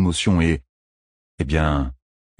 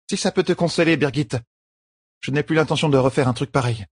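lightly voiced, higher-pitched queen; a higher voice, making peace; placating young queen; 8 semitones higher than usual. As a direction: offer a conciliatory promise. Voices Elaine placating Birgit.

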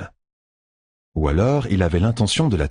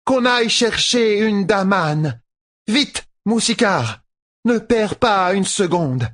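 high, drawn-out imperious command; Tuon crying out; 13 semitones higher, slower than usual. A narrator's reading. Empress sends for a Daman, crying out commands. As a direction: call out urgent orders, high and stretched. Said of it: "s'écria-t-elle".

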